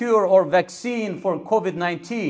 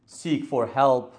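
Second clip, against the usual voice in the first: normal talking voice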